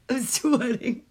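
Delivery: normal talking voice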